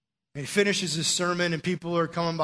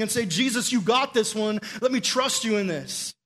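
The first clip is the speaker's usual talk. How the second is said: raised, louder preaching push; rally the congregation to trust Jesus; fervent preaching; 6 semitones higher, 3 dB louder than usual. Acted. Preaching at full force.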